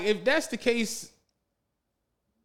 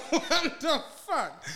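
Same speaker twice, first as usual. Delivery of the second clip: laughing; 6 semitones higher, much slower than usual. Real, not acted. Disbelief at the frozen pizza.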